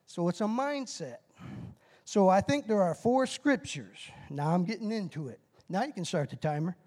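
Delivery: usual speaking voice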